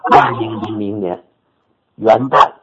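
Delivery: ordinary talk, nothing marked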